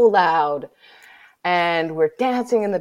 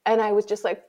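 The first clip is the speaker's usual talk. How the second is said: usual speaking voice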